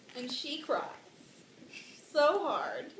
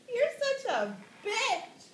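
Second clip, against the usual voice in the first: high, slow mock accusation; accusing son; 11 semitones higher than usual. Hamlet to his mom, high hammed-up accusation. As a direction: draw out the insult in raised pitch. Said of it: "And he's like".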